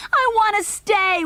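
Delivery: put-on high, loud tearful child's wail; weeping. A narrator's reading. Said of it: "wept Junior".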